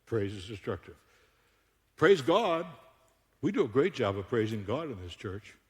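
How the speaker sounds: ordinary talk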